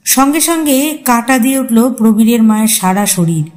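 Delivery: usual speaking voice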